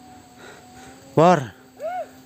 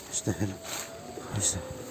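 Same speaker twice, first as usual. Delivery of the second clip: low, much softer murmured Astagfirullah; muttered sigh; 8 semitones lower, 18 dB quieter, much faster than usual. Real, not acted. After being shooed away by the man: muttered under breath.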